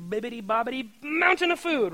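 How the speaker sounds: sing-song it like a fairy godmother casting a spell; magic spell. Retold or imitated. Jesus imagined conjuring food like a spell, playful incantation.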